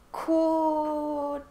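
"Quartet" with a long drawn-out first syllable; hesitant pronunciation. Real, not acted. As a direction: try out "Quartet" unsurely, pausing inside it. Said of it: "not good at, um, pronouncing this word".

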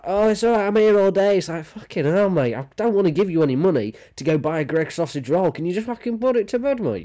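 a hint of a fed-up bloke losing patience; lightly voiced annoyed Londoner; imitating exasperation.

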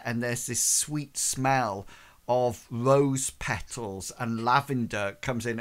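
normal talking voice

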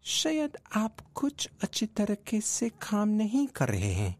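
high, slow, frail guru voice; thin, feeble voice. Acted. Old guru answering his disciple's business question, thin and feeble. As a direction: speak it slowly in a high, feeble thread of a voice. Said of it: "in a thin, feeble voice".

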